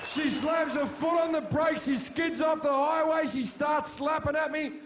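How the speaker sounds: rising panic, yelled out; excited shouting. Retold or imitated. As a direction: shout the chaos as it unfolds. Frantic retelling of car fight.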